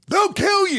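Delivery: very high-pitched mock panic; alarmed parent. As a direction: cry out like a horrified parent. Parent's alarmed reaction, acted out.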